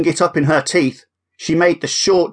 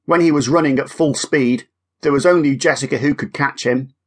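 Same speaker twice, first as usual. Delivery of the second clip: ordinary delivery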